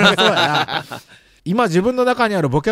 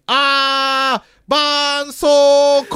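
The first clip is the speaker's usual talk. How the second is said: high, slow drawn-out cry; retold yell; 7 semitones higher, 4 dB louder, much slower than usual. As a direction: wail the word like a kid. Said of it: "と絶叫していました".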